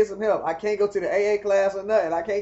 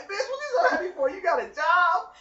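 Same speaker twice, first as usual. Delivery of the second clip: mocking challenge; 11 semitones higher than usual. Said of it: "I'm like".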